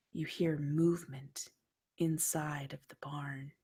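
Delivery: dark dream narration; hushed horror narration. A narrator's reading. GM narrating a creepy dream scene.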